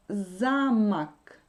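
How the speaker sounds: "Замок" with a long, loud first syllable; "Замок", drawn out and stressed at the start. Shown as a demonstration. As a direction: lean hard on the opening "За" of "Замок". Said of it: "Si l'accent tombe sur la première syllabe".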